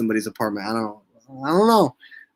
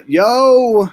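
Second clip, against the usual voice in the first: excited yo; 10 semitones higher, 8 dB louder than usual. Real, not acted. Greeting viewer Jackson excitedly.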